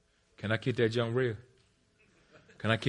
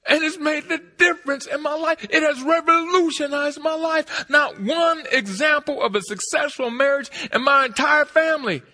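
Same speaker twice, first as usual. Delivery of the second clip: loud, high, stretched-out sermon shouting; full-force preaching; 12 dB louder than usual. Real, not acted. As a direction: preach it to the rafters.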